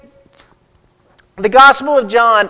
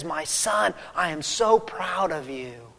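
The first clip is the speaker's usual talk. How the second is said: much softer, an intimate hush; tender pride; 2 semitones lower, 10 dB quieter than usual. Retold or imitated. God the Father telling his son he is proud, softly. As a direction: murmur it like a father's blessing.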